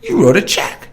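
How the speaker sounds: put-on high child's voice, matter-of-fact; the toddler calmly explains; little son. Voicing his young son.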